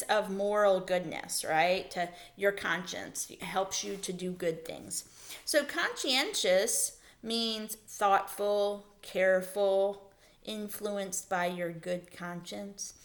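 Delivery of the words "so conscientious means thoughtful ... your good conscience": slow explanation. Shown as a demonstration. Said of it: "teacher explains word slowly".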